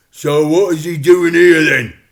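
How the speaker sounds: high, loud angry crook; demanding. Acted. Crook Thumbs demands an answer from dad, loud and high. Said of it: "demanded Thumbs".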